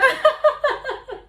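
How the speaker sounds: laughter jumps way up, loud; high burst of laughter. Real, not acted.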